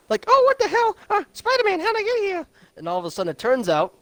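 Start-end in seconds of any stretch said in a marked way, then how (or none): 0.3-2.5 put-on much higher, quavering old lady voice; old grandma. Friend retelling the movie, voicing a confused old grandma high. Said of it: "It's some old grandma".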